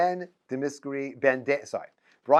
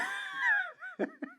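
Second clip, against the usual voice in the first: high laugh; 8 dB quieter than usual. Real, not acted. Laughing at confused Levi answers.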